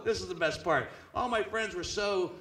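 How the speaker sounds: ordinary talk, nothing marked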